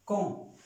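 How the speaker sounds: "con" with the vowel as a nasal O. Shown as a demonstration. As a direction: say "con" with a nasal O, final consonant silent. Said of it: "tiene un acento nasal que suena O". ("con" con O nasal)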